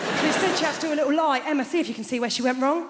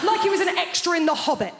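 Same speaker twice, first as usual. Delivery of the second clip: ordinary delivery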